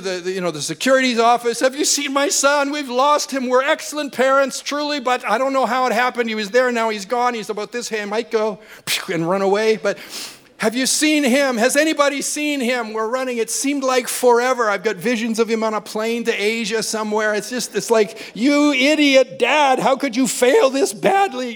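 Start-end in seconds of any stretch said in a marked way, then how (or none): 1.6-13.4 panicked plea. Retold or imitated. Panicked father at security desk. 18.4-21.5 self-scolding. Retold or imitated. Inner voice berating himself.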